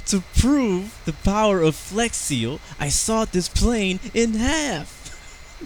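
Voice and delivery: high, slow cartoonish TV pitchman voice; Flex Seal ad impression. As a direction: ham up an infomercial announcer. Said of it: "That's such a stupid commercial".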